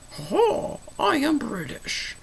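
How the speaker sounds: badly faked British, slow and booming up high; mock British accent. Creator talking as a true Brit, in mock British accent. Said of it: "not even an attempt at British".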